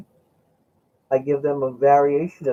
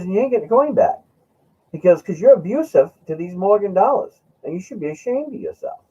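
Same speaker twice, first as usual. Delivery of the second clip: mock scolding friend; 8 semitones higher, 4 dB louder than usual. Friend scolds him for abusing coin.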